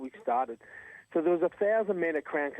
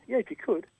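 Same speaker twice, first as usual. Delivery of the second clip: mock patient; 8 semitones higher than usual. Patiently pushing for a number.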